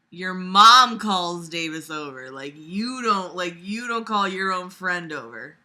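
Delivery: normal talking voice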